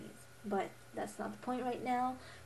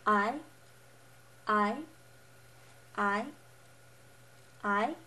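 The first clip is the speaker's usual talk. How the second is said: "I" rising like a question; second tone; 3 dB louder than usual. Shown as a demonstration. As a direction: say "I" with the pitch sweeping up, as if asking. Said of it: "pretend like you're asking a question".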